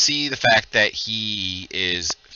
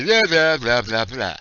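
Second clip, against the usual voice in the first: muffled wah wah talk; 2 semitones higher, 4 dB louder than usual. Pretend. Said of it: "which comes out when she hits the bottom as".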